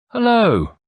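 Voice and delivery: a sharp high startled call of hello; sudden hello. Acted. Friend startled mid-story, calling out hello. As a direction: call out in alarm at the sight. Friend interrupts spotting fainted man.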